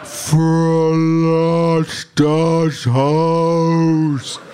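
very slow, high-pitched roaring speaker voice; huge, drawn-out subwoofer roar; booming Ken. A narrator's reading. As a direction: stretch each word into a booming command. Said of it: "dröhnt Kens Stimme aus dem Subwoofer".

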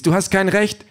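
high-pitched outraged wife; indignant protest. A narrator's reading. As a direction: object sharply in a high voice.

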